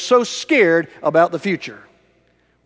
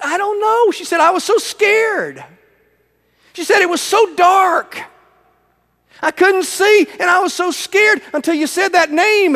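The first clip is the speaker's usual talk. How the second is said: put-on much higher frightened child voice; little girl's voice; 12 semitones higher than usual. Ghanaian girl telling the pastor, in a high frightened child voice. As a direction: pitch it up like a scared little girl.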